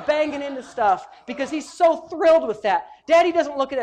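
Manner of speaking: loud, high-pitched preaching; ringing, emphatic sermon delivery; impassioned preaching. Real, not acted. Preaches at full force.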